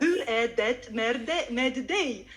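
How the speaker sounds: "Hur är det med dig" halting, word by word with every letter sounded; laboured, spelt-out learner Swedish, stumbling on "med"; reading from spelling. Retold or imitated. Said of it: "They have a written image".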